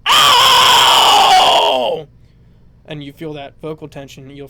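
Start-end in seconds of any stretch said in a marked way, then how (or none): 0.1-2.1 very high loud held screech; sustained high fry scream; held monkey scream. Shown as a demonstration. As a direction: hold the monkey yell out. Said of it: "once you get that monkey noise ... hold that".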